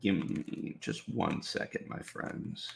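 soft, low aside while busy; half under the breath, attention elsewhere; distracted murmur. Real, not acted. Streamer asks chat for a second, distracted murmur. Distracted, starting to type a post.

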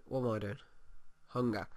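ordinary talk, nothing marked

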